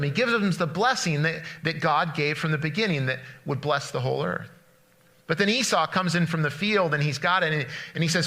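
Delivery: usual speaking voice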